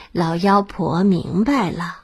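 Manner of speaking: ordinary delivery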